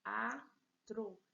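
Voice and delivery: teatro split te, a, tro with pauses; syllable by syllable. Shown as a demonstration. Teacher showing students three syllables, teatro broken apart. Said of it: "três sílabas".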